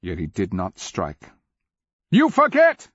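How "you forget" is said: very high, loud shout; piercing, forceful shout. A narrator's reading. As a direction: hurl it down at full voice. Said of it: "Cleric shouted".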